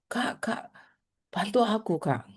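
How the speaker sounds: breathless pleading whisper; frightened whisper. Retold or imitated. Teacher voicing a lost girl on the plane, whispering. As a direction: beg for help under your breath.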